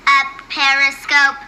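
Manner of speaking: slow, even-pitched robot echo; robot girl. Acted. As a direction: repeat the command like a machine. Robot girl echoes a command.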